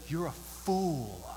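lightly voiced grave pronouncement, stretching the key word; soft, weighty quote, letting it sink in; Solomon's verdict. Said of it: "Solomon says".

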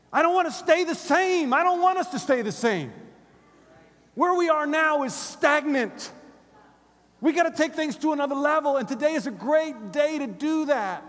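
loud, high, impassioned preaching; rally the congregation at full volume; full-force preaching. Real, not acted.

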